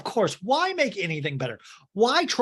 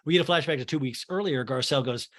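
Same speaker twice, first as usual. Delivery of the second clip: ordinary delivery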